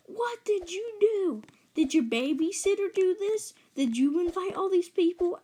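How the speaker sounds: high-pitched mock interrogation; imitated screaming mom. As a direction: fire accusing questions like an upset mom. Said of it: "my mom is screaming at me".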